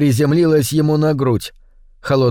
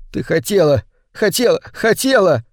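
higher, climbing distraught protest; tearful accusation; 6 semitones higher than usual. A narrator's reading. Sobbing man accusing his wife, tearful insistence. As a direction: insist on it, pitch rising with each repeat.

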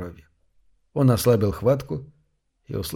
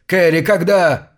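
отчаянное требование; 6 semitones higher, 6 dB louder, slower than usual. Acted. Трясётся, требует ответа.

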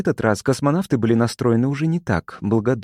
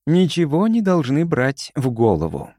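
ordinary delivery

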